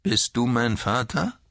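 put-on high, cracked old man's voice; brüchige Greisenstimme. A narrator's reading. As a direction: voice a frail old man, pitched high. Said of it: "mit greiser, brüchiger Stimme". (greise, brüchige Stimme)

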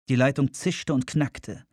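ordinary delivery

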